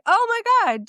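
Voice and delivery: high, bright burst of excitement; excited. Real, not acted. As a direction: gasp it out like big news. Excited opening about Kelce brothers.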